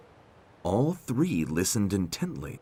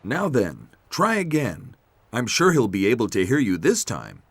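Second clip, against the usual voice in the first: lightly voiced coaxing character; encouraging Roy; 5 semitones higher, 5 dB louder than usual. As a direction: urge them on, a bit higher.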